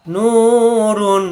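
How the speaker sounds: নূরুন with নূ drawn out long and slow; stretched vowel. Shown as a demonstration. Teacher stretching নূরুন slowly for students. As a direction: hold the নূ in নূরুন a full beat. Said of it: "এই নুকে এক আলিফ টানতে হবে".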